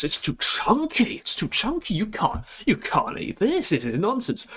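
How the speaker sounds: hammed-up disgust, pitch climbing on too chunky; mock outraged rant. Vlogger rants at a fancy burger, mock outraged.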